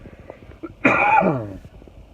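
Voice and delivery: one chesty cough, loud; real cough. Real, not acted. Sick youth pastor mid-vlog coughing, loud chesty cough. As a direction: cough hard into the mic. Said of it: "I'm gonna cough".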